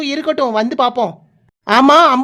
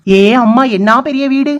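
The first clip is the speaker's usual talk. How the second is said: louder gasp of wonder; amazed exclamation; 5 dB louder than usual. Acted. Woman arriving at the leader's big house, amazed. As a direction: marvel out loud at the sight.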